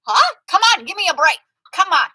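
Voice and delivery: loud, high, give me a break; exasperated. Real, not acted. Fed up with her friend's neediness, loud exasperation. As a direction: throw up your hands, loud.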